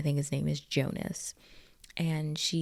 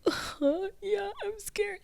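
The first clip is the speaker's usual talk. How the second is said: high-pitched, whimpering fake sob; badly faked tearful fright, drawn out; mock crying; slower than usual. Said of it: "like, crying. She's like".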